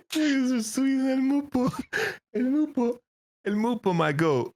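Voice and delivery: high, slow singsong; playful name-calling. Pretend. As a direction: croon the name fondly and teasingly. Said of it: "mock-sings teammate's name".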